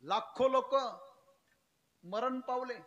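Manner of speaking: loud impassioned sermon delivery; fiery preaching. Acted. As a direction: proclaim it to the whole crowd. Preaching at full force.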